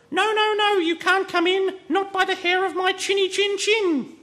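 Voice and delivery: very high, loud squeaky pig voice; tiny pig shrieking through the door; squeaky little pig. A narrator's reading. Pig calls out from behind door.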